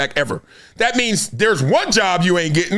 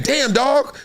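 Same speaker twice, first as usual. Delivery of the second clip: 1 mock roommate; 7 semitones higher than usual. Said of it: "him saying".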